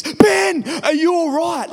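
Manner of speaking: high, loud, panicked call of his name; anguished cry. Retold or imitated. Brother shouting in anguish after Ben falls, high and loud. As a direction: brother crying out in alarm after the fall. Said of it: "It was like this cry of anguish".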